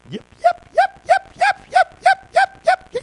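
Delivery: high shrill yipping shepherd call, shouted; shepherd's call. Retold or imitated. Preacher imitating a shepherd calling his sheep, high loud yips. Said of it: "And he would say".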